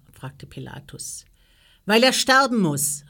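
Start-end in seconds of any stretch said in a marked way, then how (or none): 1.9-3.0 higher, much louder outcry; calling out. A narrator's reading. Priests answering Pilatus why Jesus is brought, calling out. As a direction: call it out as an insistent crowd. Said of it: "riefen die Priester".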